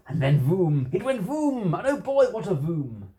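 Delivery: loud, high burst of wonder; big gleeful storybook climax; excited narration. A narrator's reading. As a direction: let the Voom explode out.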